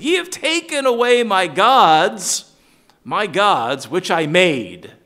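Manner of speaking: loud high aggrieved cry; indignant Micah. Retold or imitated. Preacher voicing Micah's grievance to the Danites, loud and aggrieved. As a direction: wail it out, robbed of his gods.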